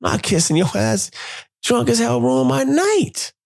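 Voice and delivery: high-pitched indignant outburst; pissed off. Retold or imitated. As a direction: refuse in a high, heated voice. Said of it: "I'm all pissed".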